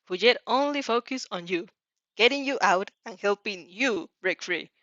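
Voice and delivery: ordinary delivery, nothing marked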